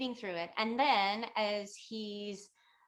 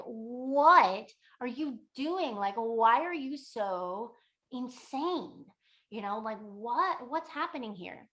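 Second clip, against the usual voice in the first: exasperated disbelief; 3 semitones higher, 4 dB louder, slower than usual. Retold or imitated. Student to the crying woman acting insane, exasperated. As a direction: demand an explanation, mock exasperation.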